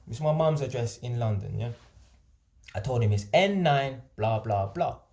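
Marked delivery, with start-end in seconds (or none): none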